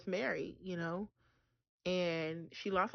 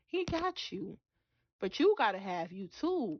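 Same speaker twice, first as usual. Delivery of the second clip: ordinary talk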